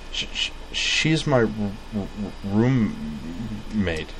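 slow stammering; stutter. A narrator's reading. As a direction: stammer on each word. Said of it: "her stutter".

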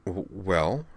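halting, stuttered start; stammering. Acted. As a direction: stumble on the first sound. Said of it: "said Caitlin".

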